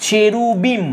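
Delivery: চেরুবিম broken as CHE-RU-BIM, final im held; syllable-by-syllable demo. Shown as a demonstration. Teacher answering what the plural becomes, চেরুবিম in syllables. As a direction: sound out চেরুবিম in measured syllables. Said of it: "তাহলে কি হয়ে যাবে?".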